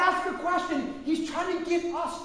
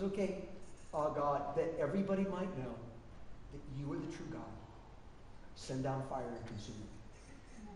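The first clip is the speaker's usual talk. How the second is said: hushed prayer; 11 semitones lower, 13 dB quieter than usual. Retold or imitated. Elijah prays quietly for fire.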